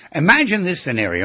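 usual speaking voice

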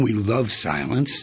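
ordinary delivery

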